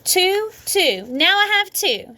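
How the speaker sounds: high, slow triumphant crowing; gloating holler. A narrator's reading. May bragging to Sheldon, high gloating holler. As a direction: rub it in. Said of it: "she hollered".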